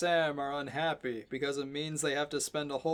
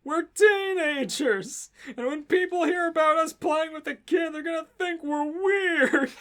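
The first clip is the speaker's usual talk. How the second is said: higher, louder, whining; whiny teen; 11 semitones higher, 7 dB louder than usual. Acted. Sam whining complaint.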